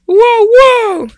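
excited. Acted. Dog cheers at new name.

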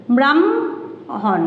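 ব্রাহ্মণ drawn out very slowly as bramhon, m before h; slow demo pronunciation. Shown as a demonstration. Teacher to pupils, slowly modelling the sound of ব্রাহ্মণ. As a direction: stretch ব্রাহ্মণ, saying m then h. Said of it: "মধ্যাহ্ন যদি বলছি ব্রাহ্মণ".

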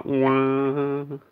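ordinary talk

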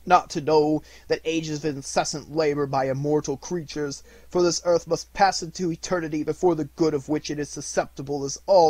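a ghost crying out its regret, slowing at the end; lamenting phantom. A narrator's reading. Phantom laments its misused life, loud and anguished.

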